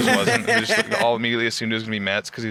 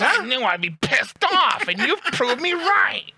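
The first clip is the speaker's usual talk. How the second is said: very high, slow honking whine; hammed-up mock indignation; Metz; 13 semitones higher, slower than usual. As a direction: drag it out in a high put-on voice. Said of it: "He goes".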